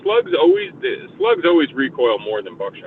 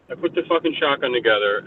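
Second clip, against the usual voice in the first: ordinary talk, nothing marked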